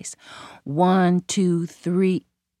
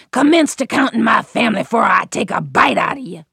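threatening gator; 2 semitones higher, 5 dB louder than usual. A narrator's reading.

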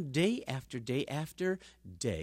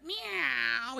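high cat meow; 10 semitones higher than usual. Acted. Cat luring mouse.

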